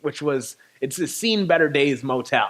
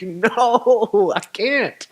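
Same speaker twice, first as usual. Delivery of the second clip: high, drawn-out wail of "can't cope"; hammed-up despairing wail; mock breakdown; 6 semitones higher, 3 dB louder, much slower than usual. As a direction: imitate falling apart, pitched way up. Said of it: "I can't hold it together anymore".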